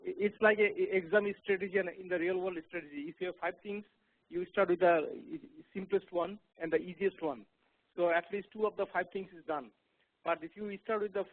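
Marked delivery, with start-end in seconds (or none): 0.0-0.7 said again much louder; to someone hard of hearing. Real, not acted. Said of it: "I couldn't hear him". 0.7-9.7 louder, speaking up; to someone hard of hearing. Real, not acted. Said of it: "You have to speak up".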